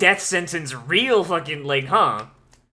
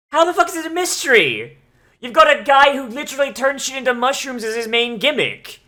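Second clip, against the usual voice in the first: higher, louder exasperated rant at the screen; 5 semitones higher, 4 dB louder than usual. Real, not acted. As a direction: rant in disbelief at how dumb this is. Ranting at the show's characters.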